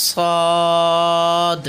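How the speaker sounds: সদ drawn out on a level tone; careful letter demonstration. Shown as a demonstration. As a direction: hold the rounded vowel of সদ long, then release the d. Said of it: "খেয়াল করতে হবে মনোযোগ দিয়ে".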